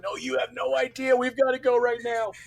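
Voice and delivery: high-pitched imitated pleading; desperate urgency. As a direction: plead as if barely holding it in. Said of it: "because it's so bad".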